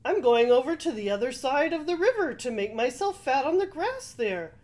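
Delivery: quick, loud, high goat voice; middle-sized billy goat. A narrator's reading. Middle goat explains to troll.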